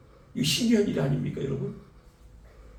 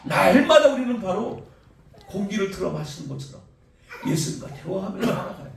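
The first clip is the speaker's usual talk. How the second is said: ordinary talk, nothing marked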